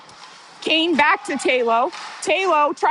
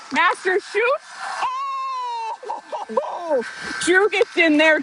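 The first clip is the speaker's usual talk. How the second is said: much higher, shot rising to a gasp and laugh; excited call; 9 semitones higher than usual. Real, not acted. Commentator calling the last-second chance, gasping then laughing. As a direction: sell the near miss with a gasp. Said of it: "I'm too worked up".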